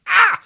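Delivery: high, loud "Ah!"; short burst of a yell; sudden loud yelp. Acted. Host breaking off a plea to stop talking, sudden yelp.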